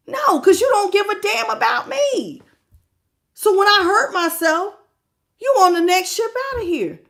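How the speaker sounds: hurt angry outburst. Acted. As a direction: let the hurt burst out as a shout. Voicing the hurt partner's refusal.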